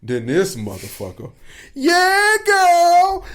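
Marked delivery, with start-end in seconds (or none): 1.7-3.2 much higher, louder mock hype voice; mock cheering friend. Imitating a man egging on the heckler, high mock hype. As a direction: ham up a guy hyping her up.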